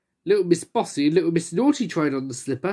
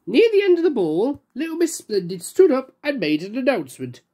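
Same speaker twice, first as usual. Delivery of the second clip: ordinary delivery, nothing marked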